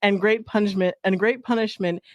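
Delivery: reading aloud. Acted. Friend reading the Saint Patrick legend, storybook style. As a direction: read the old legend with mock solemnity.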